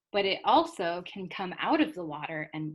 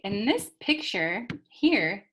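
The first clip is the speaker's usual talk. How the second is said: ordinary talk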